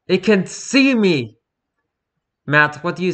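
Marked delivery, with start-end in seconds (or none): 0.1-1.4 high, slow terrified cry; panicked cry. Acted. Struggling prisoner cries out in terror, high and slow. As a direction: cry out as you fight the straps. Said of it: "Matt begins struggling".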